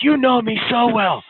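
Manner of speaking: high, loud, drawn-out mock gushing; imitated gushing. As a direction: stretch it out high, imitating a thrilled player. Player's delighted reply, retold.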